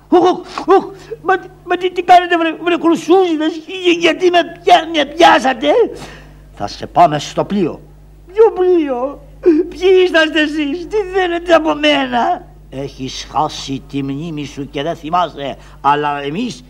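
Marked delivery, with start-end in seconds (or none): 0.1-5.7 high, loud, stammering panic; panicked protest. Acted. Grabbed by soldiers, panicking. 8.3-12.5 high, crying protest; whining cry. Acted. Seized, crying out.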